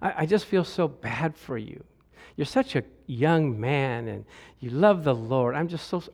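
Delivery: soft, low mock pity; pitying lady. Pastor imitating a pitying lady, hushed and low. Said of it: "the one lady says".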